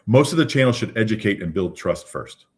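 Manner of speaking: normal talking voice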